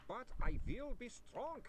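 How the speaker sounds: high, slow mild scream of resolve; a vampire shouting to resist his thirst; mock scream. A narrator's reading. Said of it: "he screamed".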